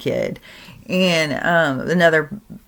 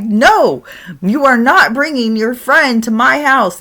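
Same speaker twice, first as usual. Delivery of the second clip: louder, higher firm grandpa; loud refusal; 7 semitones higher, 6 dB louder than usual. Retold or imitated. Grandpa forbidding another ghost friend, loud refusal. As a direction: forbid it outright.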